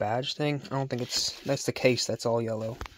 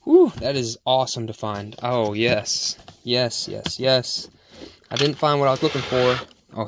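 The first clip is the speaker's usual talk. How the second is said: ordinary talk, nothing marked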